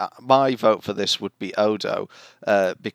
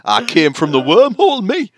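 louder, higher hammed Geordie; Geordie accent; 10 semitones higher, 9 dB louder than usual. Geordie Odo brags to the crew, hammed Geordie. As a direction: boast in a broad Geordie lilt. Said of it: "Odo's a Geordie".

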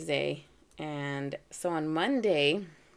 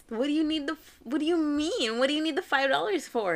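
higher-pitched, flustered questioning, restarting midway; incredulous demand; 8 semitones higher, 3 dB louder, faster than usual. Retold or imitated. Mom presses her prankster son for an explanation, incredulous demand. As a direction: retell a baffled mom pressing for answers.

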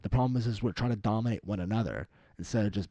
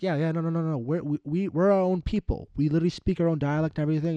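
high, loud mock protest; acted indignant objection, pitched up; exasperated insistence; 9 semitones higher, 6 dB louder than usual. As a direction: plead your case over their ignorance, raised and hurried. Acting Catalans protesting they are separate.

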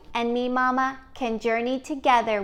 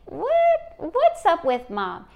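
put-on high-pitched, incredulous kid reaction; startled, suspicious child; puzzled child; 6 semitones higher, 4 dB louder than usual. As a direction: squeal the first word in disbelief. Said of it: "your child's like".

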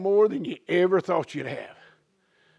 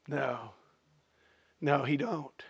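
quiet denial; 8 semitones lower, 14 dB quieter, slower than usual. Real, not acted. Preacher denies quietly.

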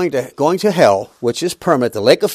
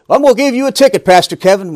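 mock cop voice, higher and louder; stern police officer; 6 semitones higher, 5 dB louder than usual. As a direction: play the officer handing out a ticket, half hammed-up. Police officer pulling pastor over.

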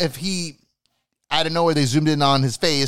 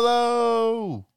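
high, drawn-out mock ring announcement; sustained high announcer call; 11 semitones higher, slower than usual. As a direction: stretch the name out like a ring announcer. Introduces wrestler in main event.

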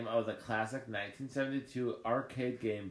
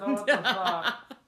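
high, loud, slow laughing no; laughing protest; 9 semitones higher, 9 dB louder, slower than usual. Real, not acted. Disgusted denial repeated.

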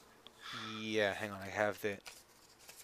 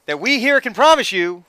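louder, higher promo voice; hammed-up announcer; mock announcer; 8 semitones higher, 6 dB louder than usual. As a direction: sell it like a TV ad.

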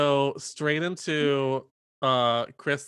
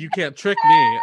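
mock defiance; 10 dB louder than usual. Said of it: "I was like".

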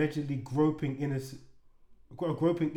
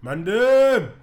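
loud, high, drawn-out battle call; rallying shout; 8 semitones higher, 12 dB louder than usual. Acted. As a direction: bellow it like you're summoning the troops. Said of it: "one of the guys stands up and he goes".

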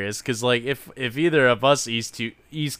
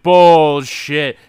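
much louder outraged shout; shouting bullshit; 4 semitones higher, 13 dB louder than usual. Retold or imitated. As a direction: yell it in disbelief. Friends call out the cult pitch.

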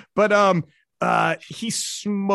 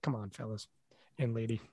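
soft complaint; 8 semitones lower, 13 dB quieter than usual. Retold or imitated. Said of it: "he'll be like".

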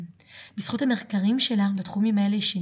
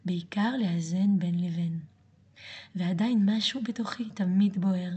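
ordinary talk

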